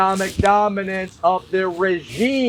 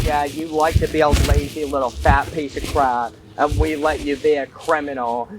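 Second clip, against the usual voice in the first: mocking boss voice; 7 semitones lower, faster than usual. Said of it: "It's like".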